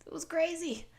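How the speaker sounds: high, loud burst of disbelief; exclaiming. Acted. Shapeshifter recalling a crowded cave childhood, exclaiming. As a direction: exclaim it, pitched well up.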